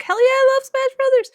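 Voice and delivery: high-pitched, gushing enthusiasm; excited yes. Retold or imitated. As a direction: squeal it like an eager fan. Acting out her eager yes.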